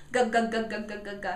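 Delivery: "g" as a run of short hard guh sounds; repeated G sound. Shown as a demonstration. Parent and child doing G sound together, repeated. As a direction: fire off "g" over and over rhythmically. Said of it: "do the G sound over and over".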